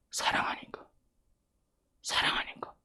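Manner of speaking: hushed, voiceless whisper. Real, not acted. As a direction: whisper it like a confided answer. Whispers the answer twice.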